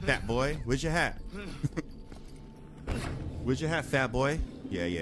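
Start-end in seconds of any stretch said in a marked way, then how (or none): 0.0-1.2 high, loud jeer; loud taunt. Real, not acted. Player mocking a fat enemy he just got, loud taunt. 1.2-3.4 long, voiceless laugh; gloating laugh. Real, not acted. Player laughing at the enemy he just beat, gloating laugh. 3.4-4.4 high, loud jeer; loud taunt. Real, not acted. Player mocking a fat enemy he just got, loud taunt.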